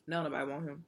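ordinary delivery

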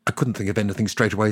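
normal talking voice

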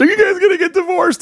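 put-on high, worried young girl voice; imitating an upset teen daughter. Daughter voiced asking parents.

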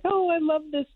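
high, bright-eyed gush of delight; gushing agent. Retold or imitated. Agent gushing over her new client, high and delighted.

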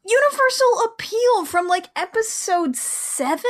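high-pitched, drawn-out disbelief; incredulous. Real, not acted. Co-host reacting to the repeated song, incredulous. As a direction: question it in exaggerated disbelief.

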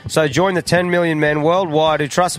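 hammed-up podcast ad voice; ad read. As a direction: sell it like a radio spot. Reads a sponsor ad.

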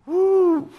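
high surprised woo; a startled whoop. Retold or imitated. Preacher hearing a plea, surprised whoop.

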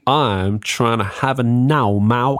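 slow, drawn-out mock American accent; exaggerated fake American twang. Shown as a demonstration. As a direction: stretch it out in a silly American accent. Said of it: "That was my American accent".

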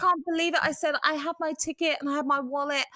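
high, hammed-up fake American accent; a poor teen imitation of American speech; teen faking an American accent to the conductor; bad American accent. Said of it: "we put on these fake American accents".

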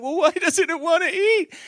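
put-on high, whiny little girl; crying kid voice. Crying daughter asking dad about the snail, high whiny. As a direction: imitate a tearful small daughter. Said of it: "then she's crying".

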